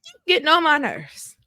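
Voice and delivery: high-pitched exasperated complaint; acted irritation. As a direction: act fed up with a partner. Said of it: "make you be like".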